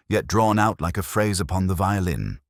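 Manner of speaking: plain reading voice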